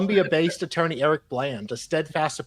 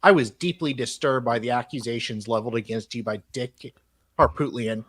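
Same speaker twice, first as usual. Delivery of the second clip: reading a letter out in a measured, formal tone; email read aloud; 3 semitones lower than usual. Acted.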